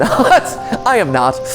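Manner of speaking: normal talking voice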